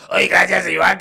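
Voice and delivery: mock an unattractive voice-message reply; ugly voice. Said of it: "Había voces muy feas".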